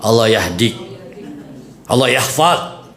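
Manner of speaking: lightly voiced scolding turned into a blessing.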